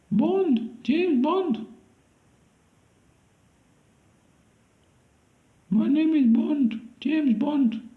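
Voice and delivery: very high-pitched put-on Indian accent. Auditioning for James Bond in a high Indian accent. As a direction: squeak it out in a mock Indian accent. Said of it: "Indian accents".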